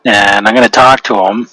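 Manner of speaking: normal talking voice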